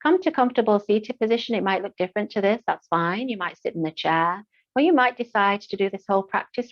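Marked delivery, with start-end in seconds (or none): none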